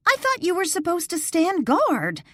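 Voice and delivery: high, loud accusing cry; startled outcry at the nurses. Acted. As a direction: yell it while running away. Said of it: "Danielle cried out".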